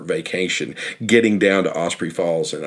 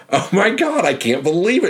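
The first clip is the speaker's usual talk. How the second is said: much higher, gushing amazement; thrilled disbelief; 10 semitones higher than usual. Retold or imitated. Stranger at the truck gushing over meeting the guide, thrilled. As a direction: squeal it like a starstruck fan. Lady thrilled to meet him.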